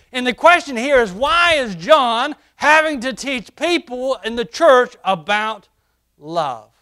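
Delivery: high, loud preacher's cry; full-force preaching. Acted. Pastor preaching to the church, ringing out.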